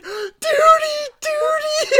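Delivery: put-on very high, loud child's cry; little girl shouting. Little girl in Caddyshack screams at the bar in the pool. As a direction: squeal it like a scared little girl. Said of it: "the little girl is like".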